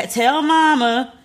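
high, slow sing-song voice; meme voice. Retold or imitated. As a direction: drawl it out like a meme. Quoting a meme voice.

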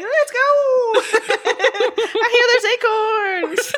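high, laughing battle cry; excited rallying cry. Pretend. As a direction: cheer them on, laughing. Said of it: "they're like".

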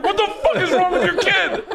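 a horrified grandpa losing it; laughing shocked outburst. Retold or imitated. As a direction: grandpa yells at his son about the kid, laughing. Grandpa reacting to spit in hand.

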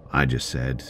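usual speaking voice

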